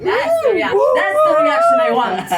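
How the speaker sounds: high, squealed delight; excited approval. Real, not acted. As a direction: cry out at getting the reaction you wanted. Delighted at the audience's "ooh.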